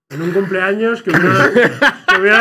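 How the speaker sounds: laughing. Real, not acted. Laughing during anecdote.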